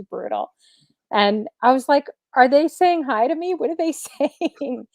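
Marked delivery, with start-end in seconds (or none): 2.4-4.9 higher-pitched puzzled questioning; acted bewilderment. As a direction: re-enact being confused, pitch lifted. Retelling her puzzled question.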